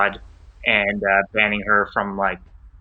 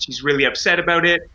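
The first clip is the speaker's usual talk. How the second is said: ordinary talk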